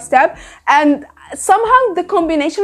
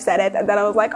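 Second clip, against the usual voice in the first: ordinary talk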